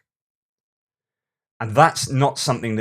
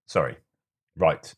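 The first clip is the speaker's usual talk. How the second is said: normal talking voice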